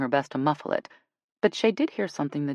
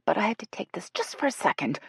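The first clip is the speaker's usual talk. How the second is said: hushed, urgent whisper; whispered apology; 6 semitones higher, 3 dB quieter than usual. A narrator's reading. Jill excusing her phone call, whispered. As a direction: whisper it, rising in pitch.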